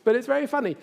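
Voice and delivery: high, helpless giggling voice; giggly bystanders. Retold or imitated. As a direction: play the office folk who can't stop laughing. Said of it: "and someone says".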